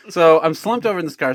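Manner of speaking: ordinary talk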